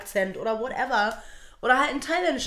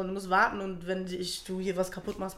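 usual speaking voice

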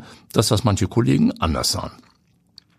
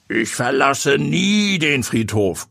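growling hooded figure; 7 semitones higher, slower than usual. A narrator's reading. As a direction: growl it slow and eerie. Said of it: "knurrte es aus dem gesichtslosen Umhang".

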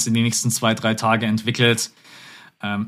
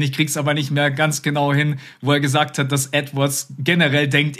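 usual speaking voice

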